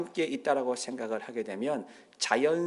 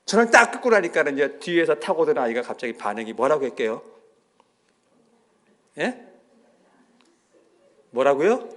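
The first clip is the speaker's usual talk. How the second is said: ordinary talk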